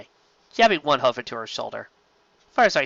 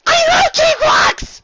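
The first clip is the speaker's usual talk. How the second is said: much higher, loud, slow gleeful shout; excited squeal; 10 dB louder, slower than usual. Acted. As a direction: squeal with hyper delight.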